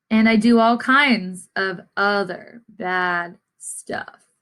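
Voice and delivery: put-on gentle read-aloud to young listeners; story narration. A narrator's reading. Teacher reading the class a picture book, storytelling voice.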